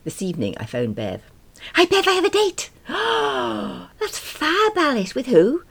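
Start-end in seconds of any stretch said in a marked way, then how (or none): 1.8-2.7 high, loud burst of news; excited announcement. Acted. Tells friend of her date. 2.9-3.9 high, delighted gasp; excited gasp. Acted. Friend reacts to date news. 4.0-5.6 high-pitched, excited squeal; thrilled friend. Acted. Friend thrilled by date news.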